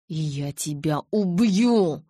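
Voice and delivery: slow, husky threat; strained croaking menace; hoarse with hatred. A narrator's reading. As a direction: rasp it out through hate. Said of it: "севшим от ненависти голосом прохрипел Джейкоб".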